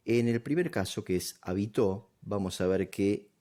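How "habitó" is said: "habitó" with the accent on tó; stress on last syllable. Shown as a demonstration. Teacher repeating the first case, "habitó" stressed on tó. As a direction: land on the end of "habitó": ha-bi-TÓ. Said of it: "la sílaba acentuada es la última".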